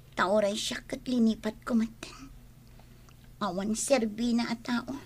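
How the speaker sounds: hushed whisper aside. Acted. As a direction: whisper it under your breath. Aside murmured to herself.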